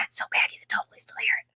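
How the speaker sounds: hushed, voiceless whisper; retold whisper. Parents confer about the crying baby at night, secretive whisper. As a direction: whisper it as if sneaking round a corner.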